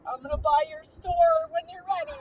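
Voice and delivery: high, loud mock villain; hammed-up villain's threat, pitched high and loud; bad guy. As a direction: taunt like a cartoon bad guy. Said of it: "then the bad guy's all like".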